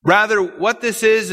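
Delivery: ordinary delivery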